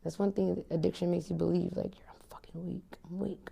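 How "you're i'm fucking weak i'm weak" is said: breathy whisper, voicing the addiction's lie; whispered self-doubt. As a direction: whisper it like a shameful inner voice.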